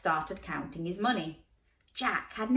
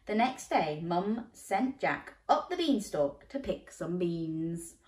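usual speaking voice

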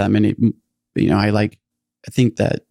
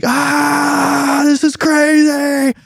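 very high, drawn-out mock wail; freaking-out sixteen-year-old; much slower than usual. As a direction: wail it like an overwhelmed teenager.

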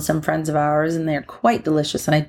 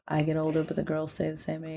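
lightly imitated laid-back movie star, much softer; Matthew McConaughey impression; 11 dB quieter than usual. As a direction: ease into the line like a relaxed film actor, quietly. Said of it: "what's the name of that actor?".

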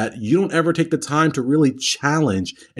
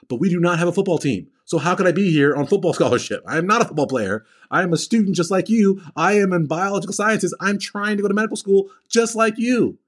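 retold protest; 6 semitones higher than usual. Protesting classmates' assumption, acted out.

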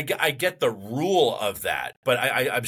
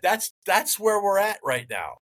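real annoyance; 6 semitones higher than usual. Real, not acted. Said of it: "and I'm angry".

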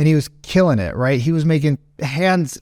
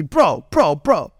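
lightly voiced big dude interjecting, raised pitch; big Middle Eastern guy; much slower than usual. Friend tries to calm the angry customer, imitated insistently. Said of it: "Middle Eastern guy, big dude".

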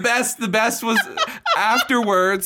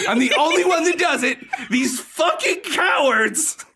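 laughing outrage; 8 semitones higher, slower than usual. Real, not acted. Outraged retelling of the joke.

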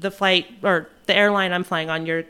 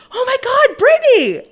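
much higher, loud shriek of a name; excited shriek; 10 dB louder than usual. Retold or imitated. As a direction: squeal the name like spotting an old friend. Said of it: "I hear this voice".

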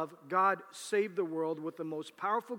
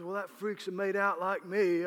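put-on Australian accent; 3 semitones higher, 5 dB louder than usual. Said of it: "And he goes".

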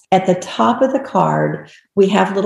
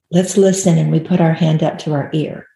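ordinary delivery

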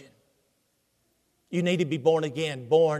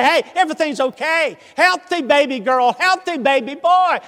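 high, loud, joyful good news; excited announcement; 8 semitones higher, 6 dB louder than usual. Acted. Doctor announces a healthy baby to waiting family, excited.

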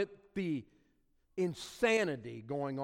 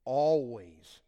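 "Always" hit hard and loud; stress on Always; 4 semitones lower, 5 dB louder than usual. Shown as a demonstration. Preacher pointing the church to the word "Always", emphatic. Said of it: "look at the emphatic words".

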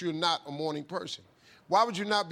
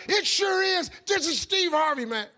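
much higher, loud and jubilant; cheerful shout; 10 semitones higher, 7 dB louder than usual. Retold or imitated. Steve answering the phone, shouting with glee. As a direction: shout it out like a game show host.

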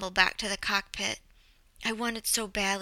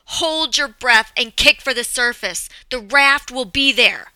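full-force shouted orders, raised pitch; screamed back; 4 semitones higher, 13 dB louder than usual. A narrator's reading. Co-pilot to passenger before throwing her out, screamed. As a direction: yell instructions over wind and rain. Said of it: "screamed back".

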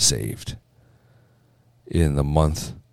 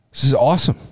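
excited; 5 semitones higher, faster than usual. Real, not acted. Host cheering the new bans.

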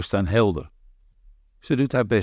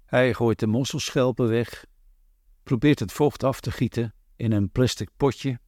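ordinary delivery

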